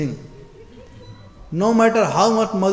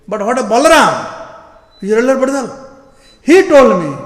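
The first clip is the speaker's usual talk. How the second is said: mother's challenge; 5 semitones higher, 7 dB louder, faster than usual. Acted. Mother Yashoda confronting Krishna.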